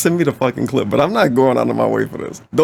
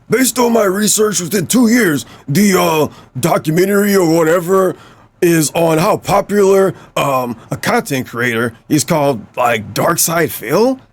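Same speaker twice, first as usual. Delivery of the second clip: dopey cartoon bellow, higher; troll voice; 5 semitones higher than usual. Shown as a demonstration. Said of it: "we gotta do the troll voice".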